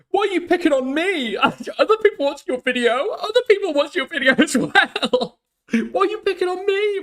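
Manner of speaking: high, whiny complaining voice; whiny mock voice. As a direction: whine like a bullied kid.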